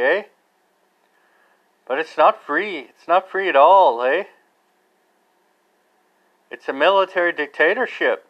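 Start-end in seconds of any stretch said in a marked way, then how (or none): 0.0-0.2 a light Canadian tag, eh; mock Canadian eh. Teasing his Canadian neighbours with a mock Canadian eh. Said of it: "appreciation for the way you talk". 4.0-4.2 a light Canadian tag, eh; mock Canadian eh. Teasing his Canadian neighbours with a mock Canadian eh. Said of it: "appreciation for the way you talk".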